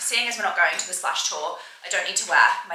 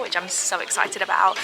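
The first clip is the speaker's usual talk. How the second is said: usual speaking voice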